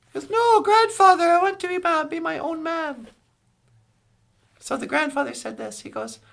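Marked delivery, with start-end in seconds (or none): 0.3-3.1 higher, louder plea to break free; protesting grandson, voice jumps up then eases down; acted protest. Grandson refusing grandfather's wish to keep him home, protesting.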